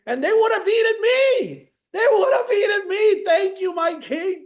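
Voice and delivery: high, loud, rising revelation; the advisor shouts his gratitude to the king; triumphant advisor. Retold or imitated. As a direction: burst out with the realisation. Advisor explains, grateful.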